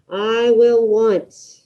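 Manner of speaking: very slow, deliberate prompt; slow dictation. Shown as a demonstration. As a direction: spell it out slowly for the class. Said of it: "Let's do one more together".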